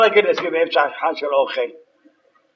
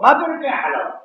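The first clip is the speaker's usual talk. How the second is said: mock yell; 7 semitones higher than usual. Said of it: "אני אצעק לך".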